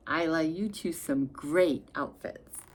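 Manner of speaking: plain reading voice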